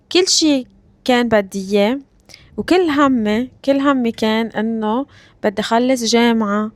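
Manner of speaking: ordinary delivery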